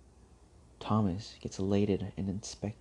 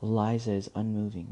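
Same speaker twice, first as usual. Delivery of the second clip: plain reading voice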